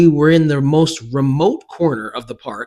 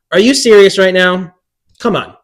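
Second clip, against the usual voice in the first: higher, louder retold disbelief; exasperated; 6 semitones higher, 9 dB louder than usual. Daughter confronts mom over the e-reader gift, retold exasperation. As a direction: hit the question hard, exasperated, then drop on the tag.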